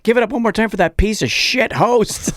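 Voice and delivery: loud, high announcer sneer; hammed-up comic roasting the host; mock emcee intro. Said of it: "Vince goes up and it's like".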